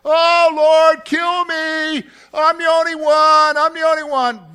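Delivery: high, loud anguished plea; complaining Elijah. Retold or imitated. Elijah begging God to kill him, loud and anguished. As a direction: beg the Lord to end it. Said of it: "Elijah complains to God and says".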